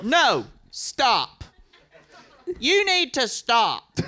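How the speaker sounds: high, loud, drawn-out protest; imitating an outraged heckler; heckler's shout. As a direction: holler it across a crowded room. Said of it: "I hear from the back of the room".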